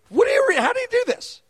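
high-pitched, wide-eyed asking; young believer's awed question. Retold or imitated. Telling the congregation what the young believer asked, high and awed.

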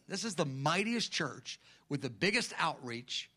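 ordinary delivery

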